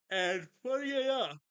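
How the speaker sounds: yawning. Real, not acted. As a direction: yawn right through the phrase. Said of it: "I'm so tired".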